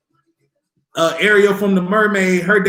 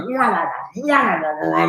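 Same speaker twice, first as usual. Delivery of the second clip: mock whimper. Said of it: "you be like".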